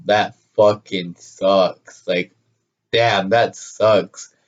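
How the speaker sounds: low, very slow retold letdown; deadpan dismay. Retelling his slow, sinking reaction.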